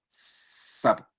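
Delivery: Sapo con la s soplada adelante; s bien articulada. Shown as a demonstration. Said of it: "la fricción se produce aquí". (Sapo con s sin voz)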